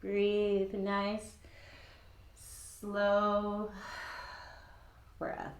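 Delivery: usual speaking voice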